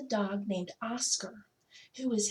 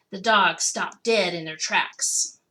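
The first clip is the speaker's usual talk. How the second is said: plain reading voice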